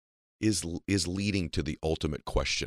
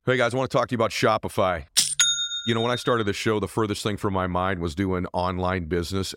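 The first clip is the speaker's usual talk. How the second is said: punchy, louder ad-read delivery; sponsor ad read; 3 semitones higher, 4 dB louder than usual. Real, not acted. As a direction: sell it with energy, leaning on the brand name. Reads a sponsor ad.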